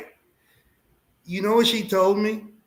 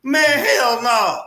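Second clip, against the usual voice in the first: scared hell no; 6 semitones higher, 6 dB louder, slower than usual. Retold or imitated. Scared child in cemetery.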